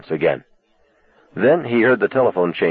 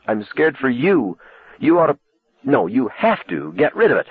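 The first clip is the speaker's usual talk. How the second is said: near tears; 7 semitones higher than usual. A narrator's reading. Lee begging Arnie about the car, near tears. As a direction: voice rising, close to crying. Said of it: "even closer to crying".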